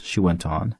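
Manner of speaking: plain reading voice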